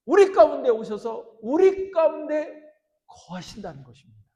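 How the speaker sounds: loud, high preaching with drawn-out key words; impassioned preaching. Acted. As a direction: proclaim it at full force to the congregation. Preaches at full force. (힘차게 외치는 열정적인 설교)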